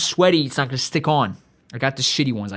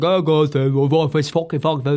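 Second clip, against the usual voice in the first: badly faked English accent, run together; mock an English fan in a bad accent; garbled, hammy attempt at an English accent; terrible English accent; slower than usual. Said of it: "and that's a terrible accent".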